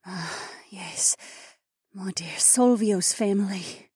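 high, slow, whispery voice; drowsy murmur. Acted. Fading mother remembering her mate's family, murmured and breathy. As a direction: murmur it as you drift off. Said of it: "Lena murmured as her eyes drooped".

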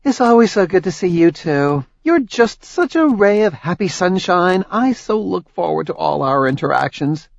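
sugar-coated irony; good-natured sarcasm. Acted. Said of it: "Tori smiled good-naturedly".

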